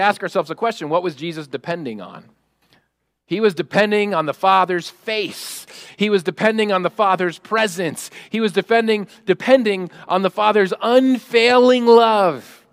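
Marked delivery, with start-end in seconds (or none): 3.3-12.7 emphatic preaching, rising to a loud peak; louder and higher with each phrase; building proclamation. Real, not acted. Preacher answering what Jesus was depending on, building proclamation.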